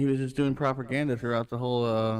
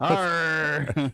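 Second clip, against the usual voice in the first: mock pirate "arr", a little raised; pirate growl; 3 semitones higher, 4 dB louder than usual. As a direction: growl out a playful pirate noise. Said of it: "You sound like a pirate".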